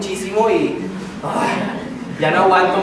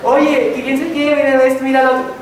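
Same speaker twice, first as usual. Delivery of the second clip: mock cheerful kid; 6 semitones higher, 5 dB louder than usual. Voicing the cheerful boy.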